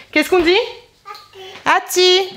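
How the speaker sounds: high, bright coaxing voice. Real, not acted. Mother prompting her baby to say merci in high baby talk.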